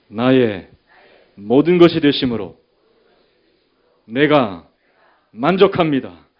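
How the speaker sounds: very loud call-and-response declaration; preaching at full force. Real, not acted. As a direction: shout each phrase for the congregation to echo. Leads congregation in shouted declaration.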